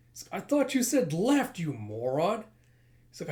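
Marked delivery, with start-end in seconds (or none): 0.4-2.4 high, loud, dropping on the insult; exasperated scolding. Acted. Leader blaming his henchman for the route, exasperated. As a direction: berate a useless underling.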